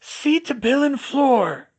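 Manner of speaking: curt, slow snap; snapping. A narrator's reading. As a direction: snap the order. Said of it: "she snapped".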